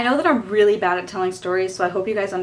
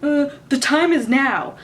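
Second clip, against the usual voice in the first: slow, higher and louder, smug and deadpan; mocking teacher voice; 6 semitones higher, 5 dB louder, slower than usual. Math teacher teasing the student who asked the time, slow smug mock voice. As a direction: play the teacher as a know-it-all drawing it out. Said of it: "And he was like".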